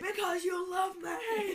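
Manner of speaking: high, drawn-out mock wail; over-the-top pleading shout; intense shouting. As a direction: bellow it slowly with absurd passion. Answering as the intense man.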